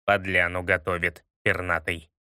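low, distrustful grumble; low suspicious voice. Acted. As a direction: say it low and wary. Smolenin's suspicious remark.

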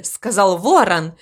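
ordinary delivery, nothing marked

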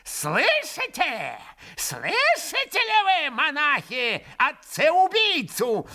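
put-on high shrill old man pouncing; attacking shriek. A narrator's reading. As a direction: lunge at him, shrieking. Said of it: "набросился Фёдор Павлович".

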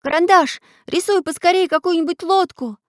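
high-voiced boy begging for help; urgent plea. A narrator's reading. Boy begs his artist friend to draw, pleading. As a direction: plead urgently, pitched well up. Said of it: "просил Чижик".

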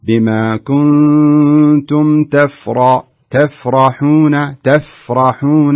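تفرحون براء مفخمة; correct tajweed demo. Shown as a demonstration. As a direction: chant تفرحون with a round, full ra. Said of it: "بهذا الشكل".

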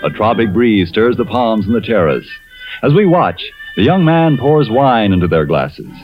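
usual speaking voice

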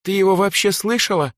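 very high, incredulous question; shocked. A narrator's reading. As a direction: voice a girl in shock asking her friend. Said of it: "Эльза в шоке перевела взгляд".

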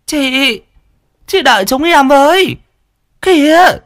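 high, loud call after her. A narrator's reading. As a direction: shout after her as you hurry. Running after elder wife.

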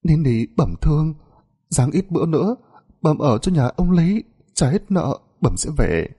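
sniffling. A narrator's reading. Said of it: "Chị sụt sịt".

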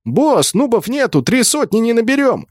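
very high, urgent shout; strained-up pitch, calling out; shouted report. A narrator's reading. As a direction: yell bad news to the boss. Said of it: "крикнул".

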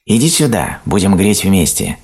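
high, inviting storybook call; hare calling out. A narrator's reading. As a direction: call a friend over. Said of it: "крикнул заяц".

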